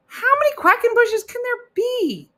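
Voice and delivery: very high, loud disbelief; incredulous squeal. Acted. Host marveling at a fan's rare surname, incredulous high squeal. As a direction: shoot the voice way up in mock amazement.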